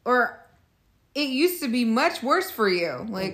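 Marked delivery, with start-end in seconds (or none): 1.1-3.0 higher-pitched mocking quote; mock dismissive men. Co-host imitating men who deny patriarchy, mock dismissal. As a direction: lightly imitate someone brushing off a complaint. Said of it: "They're like".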